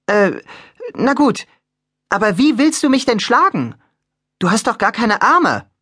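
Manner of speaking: puzzled Piper. Acted. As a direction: query it politely but doubtfully. Piper questions the armless statue.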